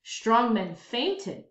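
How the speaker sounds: usual speaking voice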